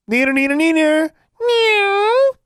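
very high, loud sing-song jeer; yelled taunt. A narrator's reading. Girl distracting cats from the ledge, yelled taunt. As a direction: tease them at the top of your voice. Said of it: "then she yelled again".

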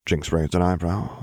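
ordinary delivery, nothing marked

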